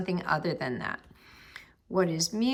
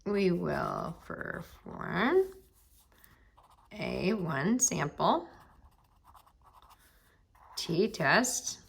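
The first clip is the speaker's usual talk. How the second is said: slow, with long gaps between phrases; dictating while writing; 2 semitones lower, 3 dB quieter, much slower than usual. Real, not acted. Teacher writing out the test step, slowly dictated. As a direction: say it at handwriting speed.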